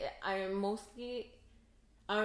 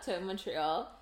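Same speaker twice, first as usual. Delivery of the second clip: ordinary talk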